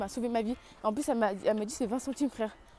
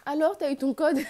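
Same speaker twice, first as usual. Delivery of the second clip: nosy neighbour; 6 semitones higher, 7 dB louder than usual. Retold or imitated. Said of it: "Même mes voisins, ils m'attendent là".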